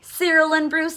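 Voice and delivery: plain reading voice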